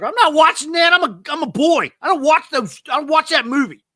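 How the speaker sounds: put-on high, loud kid protest; bratty young boy. Young boy refusing to watch Titanic, loud high protest. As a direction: whine like a small boy refusing a girl movie. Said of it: "the first time when it came out".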